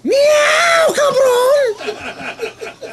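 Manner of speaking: high, loud yowling cat shout; Catman warning call. Acted. Comic sounds his Catman warning call at guys below, high yowling shout. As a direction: screech it out like an angry tomcat. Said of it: "my Catman warning call".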